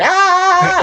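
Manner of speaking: high-pitched comic scream; mock scream at moderate volume; imitated scream. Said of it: "screaming as loud as I could".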